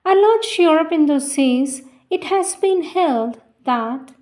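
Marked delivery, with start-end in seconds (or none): none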